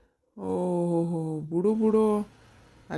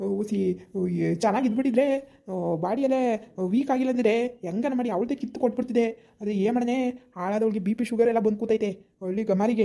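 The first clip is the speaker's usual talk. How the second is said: ordinary talk, nothing marked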